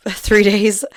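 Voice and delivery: ordinary talk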